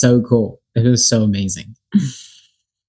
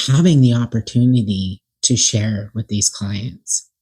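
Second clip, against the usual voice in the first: normal talking voice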